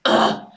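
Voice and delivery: a short, loud, annoyed ugh; frustrated groan. Real, not acted. Annoyed at her own red-light mistake, a loud ugh.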